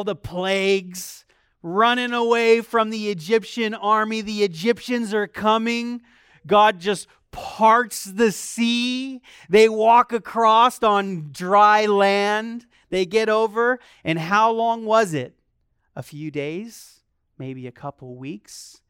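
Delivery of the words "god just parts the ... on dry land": slow, louder, every word weighed; preaching the miracle; slow emphatic preaching. Real, not acted. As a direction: lean on each word. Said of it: "preaching emphasis".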